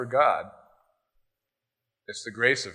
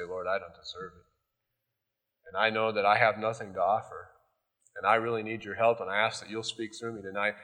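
much softer, lower humble plea; humble prayer; 4 semitones lower, 6 dB quieter than usual. Retold or imitated. Pastor humbly asking God for help, soft prayer. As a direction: pray quietly and humbly.

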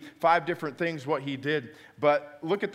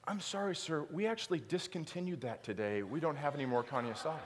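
soft apologetic clerk; 9 dB quieter than usual. Retold or imitated. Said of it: "And the lady says".

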